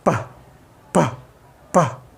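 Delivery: पा with a clean puff of air out of the mouth; air through mouth. Shown as a demonstration. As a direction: push all the air for पा out through the lips. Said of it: "air को मुंह के ज़रिए निकालने की कोशिश करोगे". (मुंह से हवा निकालकर पा)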